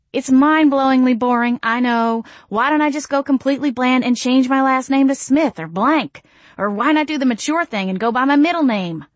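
fast, high nervous babble; babbling. Acted. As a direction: gush it out nervously. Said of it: "I started to babble".

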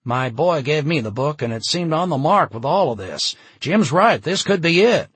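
loud, high, building excitement; excited bystander. A narrator's reading. Bystander tells the crowd Jim's right, excitedly.

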